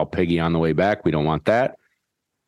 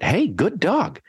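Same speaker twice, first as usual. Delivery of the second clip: high happy praise for the dog; cheery praise; 11 semitones higher, slower than usual. Shown as a demonstration. Trainer praising the dog after it sits, high and happy. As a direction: reward the dog with a bright lift. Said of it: "praise after sit".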